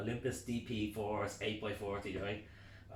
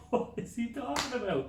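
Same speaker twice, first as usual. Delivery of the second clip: high, baffled mate voice; mock the puzzled lads; 5 dB louder than usual. Friends on the phone, high baffled.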